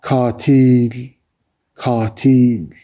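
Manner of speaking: drawn-out accusing call; mild accusing cry. A narrator's reading. Bitter wind calling to Kabil, mild drawn-out cry. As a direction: call it out long, like a crying wind. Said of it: "sanki ona bağırıyordu".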